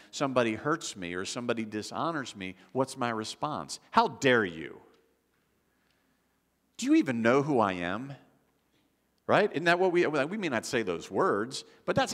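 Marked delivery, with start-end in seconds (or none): 3.9-8.1 mock-offended outburst, a touch louder; indignant arrogance. Preacher acting out our prideful response to being hurt, indignant. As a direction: act out puffed-up, wounded pride.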